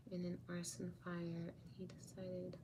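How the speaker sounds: drops to a bare whisper; whispering the sad news. Real, not acted. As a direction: breathe it out, almost no voice. Reading a sad comment aloud.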